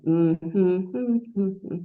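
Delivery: closed-mouth hums tracing a counting melody; hummed counting tune. Shown as a demonstration. Therapist demonstrating a counting gestalt, humming the tune. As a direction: hum the rhythm of counting, no words. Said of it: "Humming the intonation of counting".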